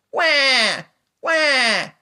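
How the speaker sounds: piercing, loud fake baby wail; hammed-up high baby crying. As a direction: bawl a put-on "wah" like an infant. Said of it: "here's the fake cry".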